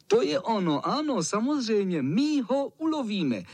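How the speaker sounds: put-on high-pitched chorus of cheering boys; jubilant. A narrator's reading. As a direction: cheer the idea on, voice soaring. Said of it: "jásali ostatní". (jásavě, vysokým hlasem)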